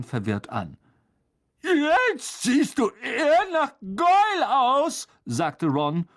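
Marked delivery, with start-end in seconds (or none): 1.6-5.1 high, slow, louder character voice; Ron pleased. A narrator's reading. Ron approves of Harry's Goyle look, high and emphatic. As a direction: exclaim it with pitch well up.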